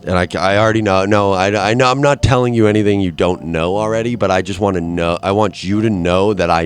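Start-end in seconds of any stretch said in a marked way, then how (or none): none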